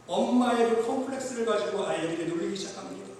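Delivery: usual speaking voice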